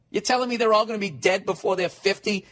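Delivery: usual speaking voice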